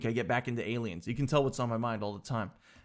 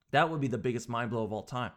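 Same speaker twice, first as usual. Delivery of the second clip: normal talking voice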